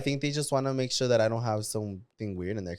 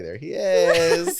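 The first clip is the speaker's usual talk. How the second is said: laughing quote; 4 semitones higher, 5 dB louder, much slower than usual. Retold or imitated. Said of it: "I'm like".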